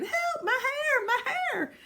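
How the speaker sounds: high, squeaky mock scream; screaming paintbrush. Paintbrush crying out at being scrubbed, mock screaming. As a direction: play a distressed paintbrush, very high and drawn out. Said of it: "it's gonna start screaming".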